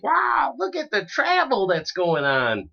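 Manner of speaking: high, drawn-out fake wow; hammed-up awe, slow and high; mock amazement. Mocking amazement at neighborly travel.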